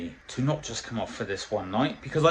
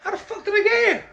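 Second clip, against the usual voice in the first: very high, loud disbelieving cry; bewildered squeal; 5 dB louder, faster than usual. Retold or imitated. Mocking his own shock at ending up on cider. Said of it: "bewildered at himself".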